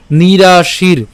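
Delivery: "নিরাশির" slowly, long vowels, closing on r; pronunciation demo. Shown as a demonstration. Teacher models "নিরাশির" with its ref, slow drawn-out vowels. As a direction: stretch "নিরাশির" and end on r. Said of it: "একসাথে উচ্চারণ করে ফেললাম".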